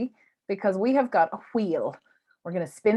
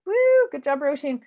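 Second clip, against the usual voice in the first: hyped giveaway announcement; 5 semitones higher, 3 dB louder than usual. Real, not acted. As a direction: build suspense, then cheer the winner. Host announces book winner.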